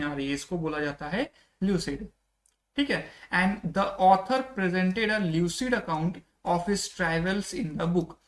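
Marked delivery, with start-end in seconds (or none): 3.3-8.2 very slow, word-by-word English reading; teacher dictating the definition slowly; slow dictation. Shown as a demonstration. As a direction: read it out slowly so students can follow. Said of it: "teacher reads English example slowly".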